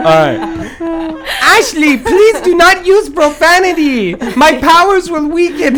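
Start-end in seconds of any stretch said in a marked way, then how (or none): 1.4-5.8 ghost voice. Acted. Christmas lady speaks.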